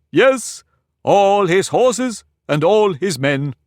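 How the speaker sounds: high, slow, lordly Humpty Dumpty voice; pompous egg lecturing a girl; haughty egg. Acted. Humpty proudly repeating.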